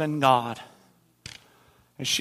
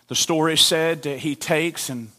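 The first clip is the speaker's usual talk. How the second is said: ordinary delivery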